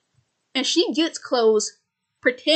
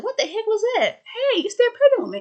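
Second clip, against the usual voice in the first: high, startled and fast; quick high-pitched alarm; startled character voice; 11 semitones higher, faster than usual. Retold or imitated. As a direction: yelp it like you just got hit by surprise. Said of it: "Jemima's like".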